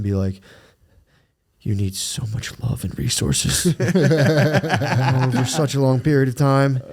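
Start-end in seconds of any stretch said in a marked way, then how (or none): 1.6-6.8 tender. Retold or imitated. Talks to infant daughter.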